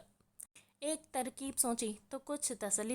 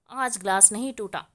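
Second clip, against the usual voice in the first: louder, grave and deliberate; serious tone; 7 dB louder than usual. A narrator's reading. Man confronting the shy girl who left the glass, grave and louder. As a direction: state it heavily, like a verdict. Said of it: "वो गंभीर लहजे में बोला".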